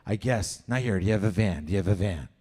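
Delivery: low, sly creep voice; creepy stranger answering the kid. Acted. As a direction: drop low and sleazy like a predator.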